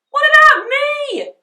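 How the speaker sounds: high, loud protest; indignant Henry. A narrator's reading. Henry complaining to Mum, high and loud. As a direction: protest like an outraged child. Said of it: "said Henry".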